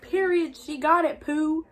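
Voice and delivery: excited cheer; mock excited. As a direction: celebrate the win playfully. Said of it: "they're all super lit".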